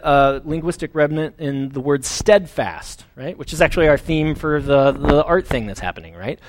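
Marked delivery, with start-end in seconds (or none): none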